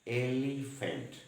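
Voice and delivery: Elephant stretched slowly, stressing the opening ए; slow emphatic word. Shown as a demonstration. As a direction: draw out elephant so its vowel start stands out. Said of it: "ए का उच्चारण हो रहा है elephant". (Elephant को धीरे, ए पर ज़ोर देकर बोलें)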